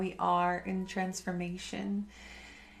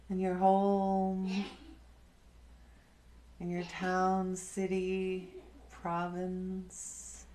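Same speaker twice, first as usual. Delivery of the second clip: guided meditation; slower than usual. Acted. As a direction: guide them inward, calm and unhurried. Leads a meditation.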